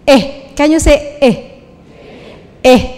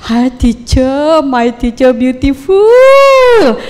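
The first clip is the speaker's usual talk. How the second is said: tiru logat Vietnam, lambat dan memanjang; much slower than usual. Retold or imitated. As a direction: murid asing memuji gurunya, lambat dengan logat Vietnam. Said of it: "Murid saya Vietnam itu suka muji saya". (murid Vietnam memuji guru dengan logat berat)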